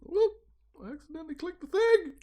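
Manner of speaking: tiny high-pitched oops voice; high squeak. Real, not acted. Reader admitting a misclick to co-host, high squeak. Embarrassed aside after misclick.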